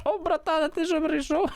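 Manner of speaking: squeaky high mock girl greeting; mock girl's voice. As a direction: play her as a chirpy girl, way up high. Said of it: "і вона така". (передражнює дівчину високим голосом)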